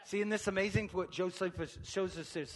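ordinary talk

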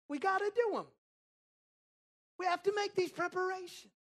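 high, loud mimicking voice; fussy Martha. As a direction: insist in a pinched mock whine. Mimicking Martha insisting on chores.

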